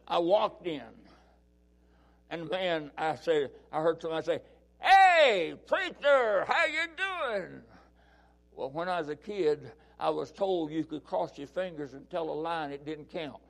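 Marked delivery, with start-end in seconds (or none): 4.8-7.6 higher, louder greeting, the last words slower; cheerful stranger hailing him; hearty call-out. Retold or imitated. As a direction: greet the preacher across the room.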